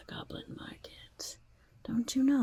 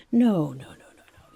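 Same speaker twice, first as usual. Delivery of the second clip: flustered no; 10 dB louder than usual. Acted. Urging customer to leave.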